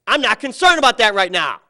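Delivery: imitating a furious coach; angry yell. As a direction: yell it to shut him down. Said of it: "Then he yells at me".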